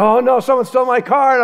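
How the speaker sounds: high-pitched, hammed-up panic; mock freak-out. Pastor re-enacting losing his car, mock panic. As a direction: panic comically, voice jumping up high. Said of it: "I'm all freaking out".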